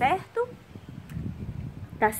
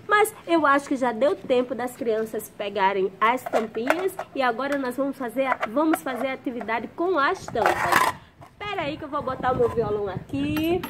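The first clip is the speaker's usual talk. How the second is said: ordinary talk, nothing marked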